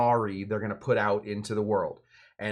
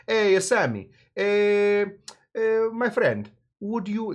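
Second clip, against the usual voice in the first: high, hammed-up Italian accent; 8 semitones higher, 4 dB louder, slower than usual. Nonino rep courting Sammy, hammed-up Italian accent. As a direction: play the Italian rep sweet-talking Sammy. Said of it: "now I'm getting into a different accent".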